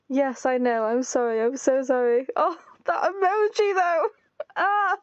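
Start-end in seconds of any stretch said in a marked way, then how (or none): 2.4-5.0 high, half-crying wail; pained whine. Real, not acted. Player reacting to a sad emoji in the game, pained whine. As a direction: whimper in dismay at the sight.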